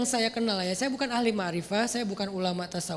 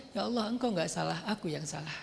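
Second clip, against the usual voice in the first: softer, lower humble admission; humble confession; 5 semitones lower, 7 dB quieter than usual. Shown as a demonstration. Preacher shows the right humble way to pray. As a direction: confess it softly, bowing your head. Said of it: "Harusnya ngomongnya".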